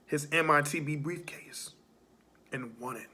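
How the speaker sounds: hushed whisper. Acted. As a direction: drop to a whisper. Whispered aside in promo.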